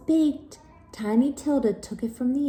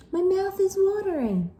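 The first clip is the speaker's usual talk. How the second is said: higher eager voice; 11 semitones higher than usual. Acted. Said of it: "said Wilda".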